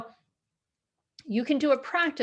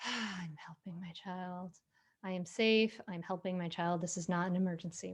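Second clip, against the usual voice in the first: whispered mantra; 4 semitones lower, 8 dB quieter than usual. Shown as a demonstration. Parent steadying themselves mid-talk, soft whispered mantra. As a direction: breathe and whisper it to yourself. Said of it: "you say".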